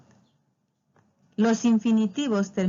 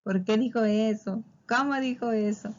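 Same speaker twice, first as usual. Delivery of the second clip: dismayed exclamation; 2 semitones higher than usual. Retold or imitated. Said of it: "es donde decimos".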